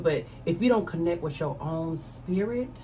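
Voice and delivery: usual speaking voice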